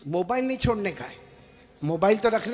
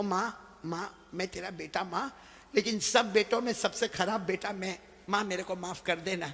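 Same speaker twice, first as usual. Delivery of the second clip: soft pleading; 9 dB quieter than usual. Acted. Child begging mother, soft.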